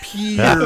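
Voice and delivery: Pierre as a single drawn syllable, "peer". Shown as a demonstration. Joking about the waterside, Pierre said as "peer". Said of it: "like on the side of the water".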